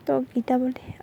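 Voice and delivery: ordinary delivery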